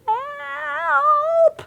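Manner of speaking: high cry from far above; cry for help. Acted. Spoon crying for help from the beanstalk, high. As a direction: call out for help. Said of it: "came a cry from above".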